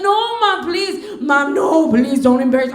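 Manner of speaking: high, loud imitation of a crying teen; crying plea. Daughter begging mother not to embarrass her, mock-tearful pleading. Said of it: "And she's crying".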